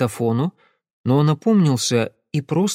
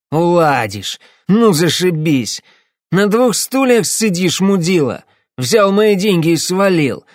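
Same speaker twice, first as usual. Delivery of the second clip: menacing shout; 7 semitones higher, 6 dB louder than usual. Acted. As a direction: blow up at him, threatening. Drunk client raging at fixer.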